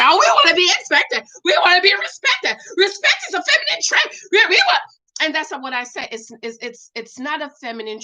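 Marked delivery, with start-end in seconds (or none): 0.0-4.8 high-pitched, hammed-up emotional whine; mock crying. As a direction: wail it in a mocking, tearful woman's voice. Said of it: "the emotional woman start crying and say".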